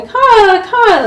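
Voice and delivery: high, loud cawing like a raven; playful raven imitation. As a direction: call out like a crow. Teller imitates a raven's call.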